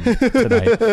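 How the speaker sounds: put-on high lingering Scandinavian accent. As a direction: keep the Scandinavian accent going. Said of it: "you can do this voice spot on".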